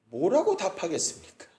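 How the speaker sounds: normal talking voice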